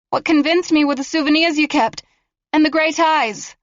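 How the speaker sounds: high, sharp accusing question; defiant girl. Acted. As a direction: throw it back at him.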